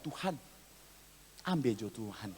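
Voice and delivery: very soft, low mock plea; resigned prayer. Wives praying to God about their husbands, hushed and low. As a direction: pray it almost inaudibly, sinking low.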